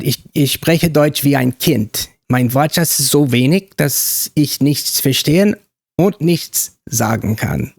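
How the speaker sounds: slow, careful German pronunciation; German accent, measured and deliberate. Shown as a demonstration. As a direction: speak German slowly in a practised accent. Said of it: "put on a pretty good German accent".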